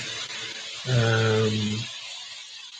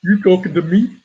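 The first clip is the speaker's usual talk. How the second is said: raised, louder challenging movie quote; punchy rising question in an actor's voice; film actor impression; 4 semitones higher, 5 dB louder than usual. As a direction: challenge them like a film character. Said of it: "best impersonation of that actor".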